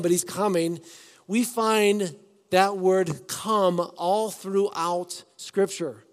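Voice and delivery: ordinary talk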